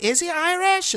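high, puzzled squeak of a question; mock a baffled viewer, way up high; confused onlooker. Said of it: "Other people are like".

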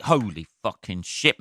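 normal talking voice

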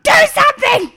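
high, loud acted shout; glaring demand. Woman mid-contraction glaring at her partner, high shouted demand. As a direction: shriek the order in mock fury. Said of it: "I just glared at him".

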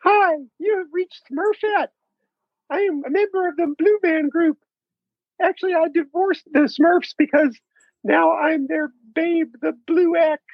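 high, squeaky cartoon girl; Smurfette voice. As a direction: pitch it way up like Smurfette. Smurfette voice on phone.

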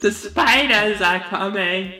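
high, slow mock Aussie warning; spooky hammed-up Aussie accent; bad Australian accent. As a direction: drag it out in a fake Australian voice. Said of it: "This is absolutely atrocious".

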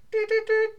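high sung alarm beeps; beeping security alarm noise; scanner beep imitation. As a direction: mimic the bag scanner going off.

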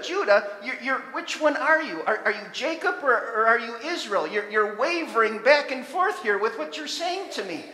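loud, pleading challenge; God questioning Judah. Acted. God confronts wavering Judah, loud and urgent. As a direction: press Judah to choose.

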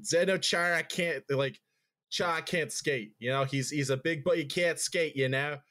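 mock Boston accent, R's dropped; aunt's Boston accent. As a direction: imitate a thick-accented Boston aunt. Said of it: "do a really good Boston accent".

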